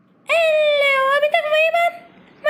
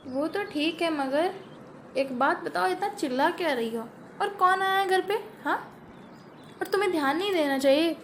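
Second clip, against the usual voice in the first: tired elder sister; 10 semitones lower, 8 dB quieter than usual. Acted. Elder sister explaining why she sat down, low and tired. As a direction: sink into a worn-out older sister.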